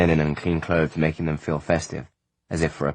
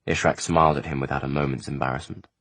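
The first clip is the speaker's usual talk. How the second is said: ordinary delivery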